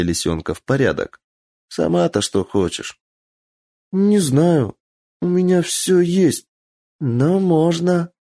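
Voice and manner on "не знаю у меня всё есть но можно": put-on high, coy child, drawn out; coy little girl. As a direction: play it sly and sweet. Girl coyly thinks.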